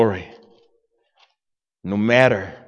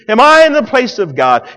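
shouted higher question, I stressed; loud climax; 9 semitones higher, 9 dB louder than usual. Acted. Joseph crying out to his brothers, loud preached climax. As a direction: bellow "Am I in the place of God?" with I hit hard.

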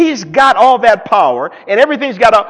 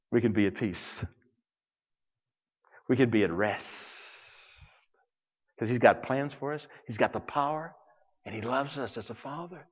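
hushed reassurance; 9 semitones lower, 18 dB quieter than usual. Real, not acted. Preacher calms the congregation.

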